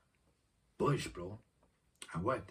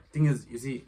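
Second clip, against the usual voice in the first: raised voice; 5 semitones higher, 12 dB louder than usual. Real, not acted. Calling out mid-vlog.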